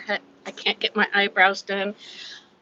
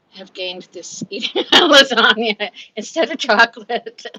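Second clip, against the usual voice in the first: louder, slower words broken by laughter; laughing while talking; 9 dB louder than usual. Real, not acted. Vlogger joking to viewers about lasagna, laughing through it.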